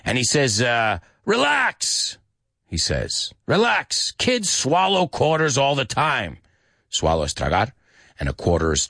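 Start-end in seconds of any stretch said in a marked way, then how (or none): 1.2-2.2 put-on high-pitched old man's reassurance; acted high, drawn-out dismissal; Grandpa. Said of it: "he plays the role of Grandpa". 3.4-6.4 put-on high-pitched old man's reassurance; Grandpa. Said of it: "He was very old in this movie".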